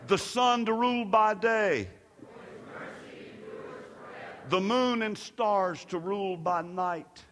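measured, projected psalm proclamation; responsive scripture reading. Real, not acted. Preacher leads congregation through the psalm of mercy, declaiming. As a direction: declaim each verse, leaving room for the response.

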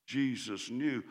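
ordinary talk

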